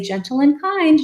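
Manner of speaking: high sing-song "Gentle and kind"; gentle reminder. Shown as a demonstration. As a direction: soothe a flailing child with the phrase. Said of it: "you just say".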